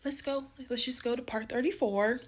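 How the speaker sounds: high squeaky exasperated aside; mock exasperation. Real, not acted. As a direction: squeal it, fed up. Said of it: "Let's all just take a deep breath".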